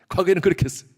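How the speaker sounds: laughing aside. Real, not acted. 웃으며 덧붙임.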